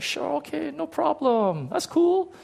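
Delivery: put-on high-pitched, agreeable child; small high voice, trying to be okay with it; little kid. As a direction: play a kid putting on a hopeful front. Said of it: "I'm a little kid. I was like".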